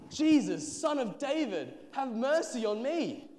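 high, loud plea; calling out loudly. A narrator's reading. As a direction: cry out for mercy over a crowd. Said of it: "so he called out loudly".

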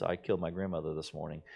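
low and soft, tossed off quickly. Retold or imitated. FDR testing the greeting line, low and quick. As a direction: mumble it low so nobody notices. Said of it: "in a low voice".

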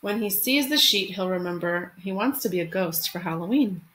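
gentle picture-book read-aloud; expressive storytime narration; reading to children. Acted. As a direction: read it like storytime for your class. Teacher reads picture book aloud.

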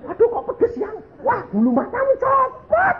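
very high-pitched shouted exclamation; comic mock alarm. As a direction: wail it like a hammed-up panic. Comic retelling of lost false eyelashes. (menirukan panik secara lucu, melengking)

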